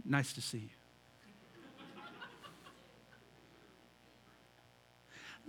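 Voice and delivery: flat polite greeting. Retold or imitated. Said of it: "You don't stand there".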